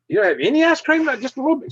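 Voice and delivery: high, mock whiny plea; pleading friend. [name] pleading with the waitress.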